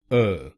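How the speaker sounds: अ as a short neutral uh; right vowel. Shown as a demonstration. Teacher repeats the weak vowel, अ as uh. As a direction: keep अ short and unstressed. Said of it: "आप इसको बोलिए अ".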